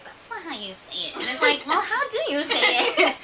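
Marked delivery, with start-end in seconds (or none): 0.0-1.1 soft, low imitation of a teasing sister; teasing correction. Sister correcting a Korean learner, soft teasing. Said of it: "my sister would tease me". 1.7-3.2 exasperated retort. Retold or imitated. Retort to teasing sister.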